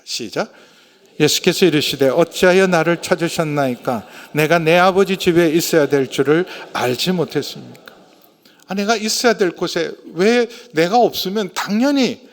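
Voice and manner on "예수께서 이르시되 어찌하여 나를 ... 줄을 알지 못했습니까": loud, measured verse reading; scripture read aloud. Real, not acted. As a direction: lead the congregation through the verse. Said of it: "사십구 절 함께 읽겠습니다". (성경 구절을 큰 소리로 함께 봉독)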